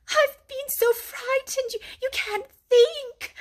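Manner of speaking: high, slow and tearful; frightened. A narrator's reading. Mole tells Rat how scared he was, high and tearful. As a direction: stammer as the fright spills out. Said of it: "he cried".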